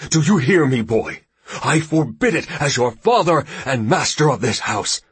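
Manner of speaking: enraged and domineering, drawing out the final order; furious father. Acted. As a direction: bellow orders at a disobedient son. Enraged father orders his son.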